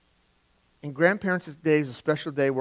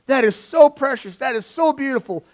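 high gushing praise; gushing grandparent; 10 semitones higher, 5 dB louder than usual. Retold or imitated. Grandparent praising a child's craft, high gushing. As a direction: coo over the child's drawing like a doting grandparent. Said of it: "a grandparent will look at that and go".

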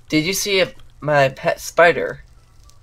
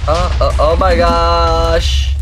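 shocked groan; 4 semitones higher, 8 dB louder, much slower than usual. Real, not acted. Falls in game, dismayed.

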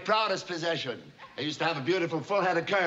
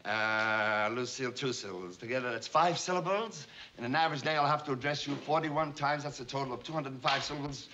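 low, soft counting to himself; muttered calculation; 5 semitones lower, 5 dB quieter than usual. Acted. Boss totting up the syllables of a secretary's name, muttered. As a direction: work out the sum under your breath.

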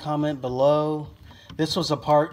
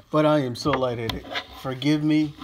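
ordinary talk

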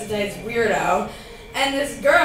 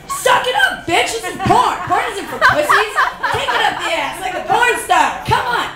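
mock yelling at the screen; 7 semitones higher, 4 dB louder than usual. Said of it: "I'm like".

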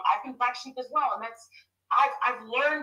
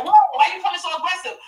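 startled, louder questioning; mock startled stranger; 6 semitones higher, 7 dB louder, faster than usual. Strangers reacting to her bluntness with startled alarm. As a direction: recoil at her tone. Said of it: "people are like".

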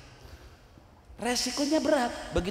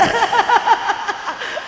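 loud, high-pitched cackle; laughing; 8 dB louder than usual. Real, not acted. Preacher cracking up at his SIM card joke, laughing. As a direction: burst into high staccato laughter.

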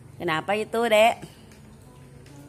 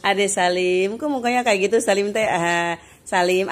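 ordinary talk, nothing marked

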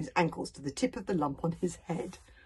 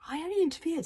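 ordinary delivery

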